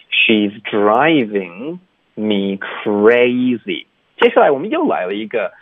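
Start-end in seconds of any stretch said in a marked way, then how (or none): 0.1-3.8 "She's driving me crazy" very slow, DRIVING and CRAZY stretched wide; over-articulate each sound of She's driving me crazy, slowly; exaggerated model. Shown as a demonstration. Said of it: "越夸张越好". (很慢、夸张地示范 She's driving me crazy)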